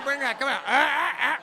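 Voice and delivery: high, loud comic demands; mock eagerness at full volume; hammed-up eager shouting. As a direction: shout for it, then open-throated ahs. Acted eager shouting in bit.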